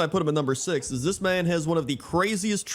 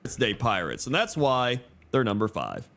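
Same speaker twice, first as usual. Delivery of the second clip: ordinary talk, nothing marked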